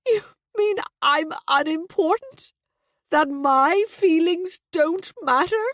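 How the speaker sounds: high, loud, tearful accusation; acted sobbing self-pity, pitched right up. A narrator's reading. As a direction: wail it as a hurt mother. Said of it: "There was a sob".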